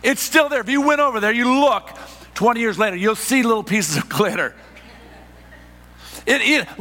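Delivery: high, loud, driving sermon delivery; full-force preaching. Acted. Pastor to congregation, preaching at full force.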